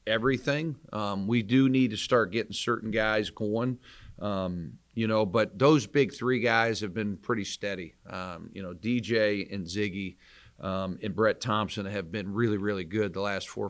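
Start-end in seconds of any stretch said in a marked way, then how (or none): none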